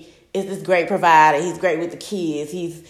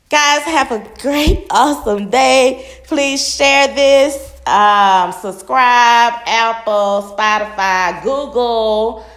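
louder, slower, bright outro call; upbeat sign-off; 3 semitones higher, 6 dB louder, slower than usual. Real, not acted. Podcaster signing off to her listeners, upbeat and loud. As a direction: wave the audience goodbye with energy.

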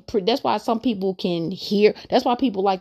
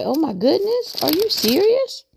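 amazed disbelief; 11 semitones higher than usual. Retold or imitated. Retelling her Bible discovery in amazed high disbelief. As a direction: pitch shooting up high in astonished wonder. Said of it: "And I was like".

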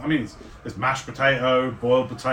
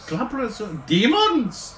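amazed; 8 semitones higher, slower than usual. Acted. Zanlin amazed.

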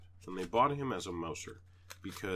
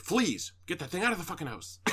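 higher, louder, fast mock outrage; hammed-up yell about fleas; 8 semitones higher, 3 dB louder than usual. Imagined person on the phone yelling about fleas, mock outrage. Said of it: "that person said".

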